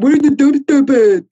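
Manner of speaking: high, slow mocking fan voice; mocking whiny fan. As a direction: whine it like a dumb fan. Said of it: "people are like".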